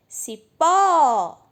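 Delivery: Cipó with PÓ called loud and drawn out; stress on final syllable. Shown as a demonstration. Teacher calling the word as at a gate, stressed Cipó. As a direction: stretch and belt the pó of Cipó. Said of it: "nem precisava chamar no portão".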